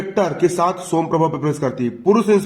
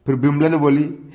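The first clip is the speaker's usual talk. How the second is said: plain reading voice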